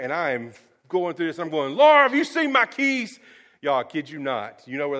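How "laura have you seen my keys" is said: high, loud call across the house. Retold or imitated. Husband calling to wife Laura about lost keys, a loud high call out. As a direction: call out to Laura down the hall, higher and louder.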